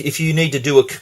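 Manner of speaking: normal talking voice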